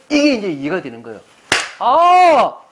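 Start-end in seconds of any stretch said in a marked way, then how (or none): 1.8-2.6 loud, high "ah" of discovery; exclaimed realization. Acted. Preacher showing the congregation the moment it makes sense, exclaimed realization. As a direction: let the penny drop loudly.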